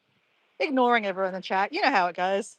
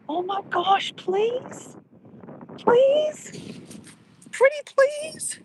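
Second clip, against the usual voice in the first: climbing higher, drawn-out begging; pleading; 13 semitones higher, slower than usual. Real, not acted. Begging a viewer to write her Paris story, rising pleading. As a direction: beg sweetly, each please higher.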